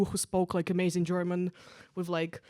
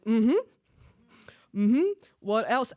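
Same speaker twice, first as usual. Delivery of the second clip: sarcastic mm-hmm; 2 semitones higher, 5 dB louder, slower than usual. Retold or imitated. Egging on the guy's rant.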